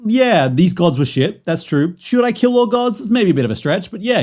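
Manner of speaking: voicing Gorr's reasoning. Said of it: "And then he went".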